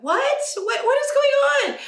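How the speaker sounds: very high, outraged squeal; disbelieving shriek; shocked kids. Retold or imitated. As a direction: kids shocked at skipping lunch inside. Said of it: "They're like".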